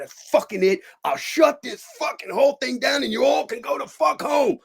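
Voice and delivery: high-pitched angry shouting; furious outburst. Retold or imitated. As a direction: shout them all out, pitch soaring. Acts out threatening to shut set.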